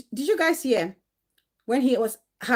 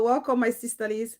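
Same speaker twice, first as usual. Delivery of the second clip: usual speaking voice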